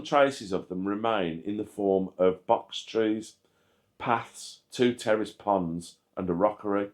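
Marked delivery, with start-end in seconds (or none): none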